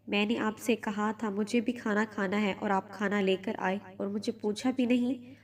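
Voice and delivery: usual speaking voice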